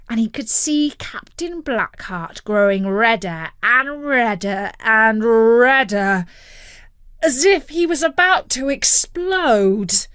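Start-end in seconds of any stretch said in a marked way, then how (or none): 2.5-10.1 building tension. A narrator's reading. Narrator shows the captain about to explode, building. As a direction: build steadily towards bursting point.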